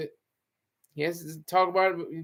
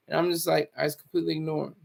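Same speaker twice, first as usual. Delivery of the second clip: ordinary delivery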